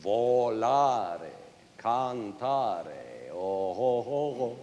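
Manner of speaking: lingering vowels in "Volare, cantare", held like song; long Italian vowels. Shown as a demonstration. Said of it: "famous for its long, beautiful vowels".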